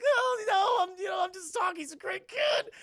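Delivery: high, strained mock-panic cry; freaking out. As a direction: squeal an excuse while hiding agony. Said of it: "he starts freaking out".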